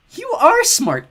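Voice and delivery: high, loud mock praise; butter him up; flattering. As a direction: Piper flattering the dim brute. Piper flattering dim Cal.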